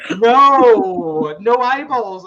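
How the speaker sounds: loud, high, drawn-out wail of refusal; mock horrified protest. Dad refusing his son's pretend dish, mock horrified wail. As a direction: wail it in playful outrage.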